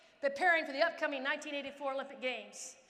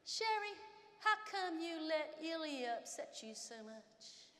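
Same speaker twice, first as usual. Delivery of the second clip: mentor's voice; 3 semitones higher, 6 dB quieter, slower than usual. Retold or imitated. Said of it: "comes up to me and says to me".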